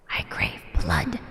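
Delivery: low, slow menacing whisper; creepy whisper. Pretend. Player roleplaying bloodlust, slow creepy whisper. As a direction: whisper it like a monster.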